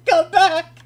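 high shouted call. Real, not acted. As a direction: call after him, high and loud. Calling after partner who left.

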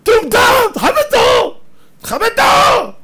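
very high, loud furious shout; angry viewers yelling at a man spoiling the film, high and loud. Retold or imitated. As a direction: bark at the intruder to show respect. Viewers yell at intruder.

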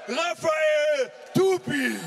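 drawn-out fighter name call; ring announcer call. Real, not acted. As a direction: stretch the name out slowly.

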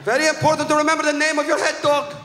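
high-pitched French guide; mock French accent, insistent and high. French guide on the head dog's name, high French-accented voice. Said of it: "this French guy is going".

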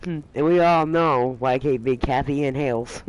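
normal talking voice

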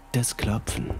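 ordinary delivery, nothing marked

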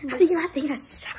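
high, disbelieving question; can't believe he means it; incredulous. Real, not acted. Friend claims it isn't sour.

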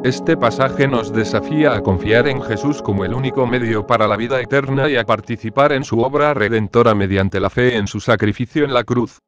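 normal talking voice

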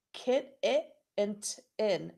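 "Kit, it, and t-, in" chopped into separate beats; clapping out syllables. Shown as a demonstration. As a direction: tap out each syllable of kitten. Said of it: "How many syllables?".

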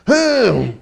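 high "hmm", imitating her objection; imitated disapproving hum. Imitating her reaction to blue cheese, disapproving hum. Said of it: "she was like".

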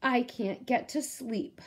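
put-on a sleepless child's mild lament; sad. Harry tells his friends he can't sleep, mildly sad. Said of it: "said Harry sadly".

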